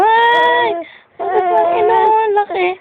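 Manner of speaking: high-pitched playful coo. Real, not acted. Teasing crying baby about nose.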